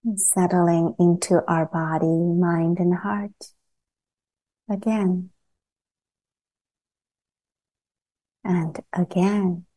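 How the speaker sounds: calm meditation guide with long pauses between phrases; unhurried, evenly pitched guidance, leaving long silences; guided meditation. Acted. Teacher guiding a group to settle into body and earth, guided meditation.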